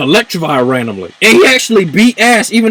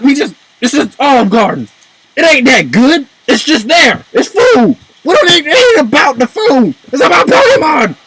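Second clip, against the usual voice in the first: worked-up rant; 5 semitones higher than usual. Real, not acted. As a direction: vent it like a fed-up fan. Heated rant about food in anime.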